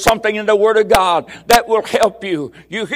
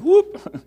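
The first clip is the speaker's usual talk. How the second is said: mock whoop; 9 semitones higher than usual. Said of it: "He said".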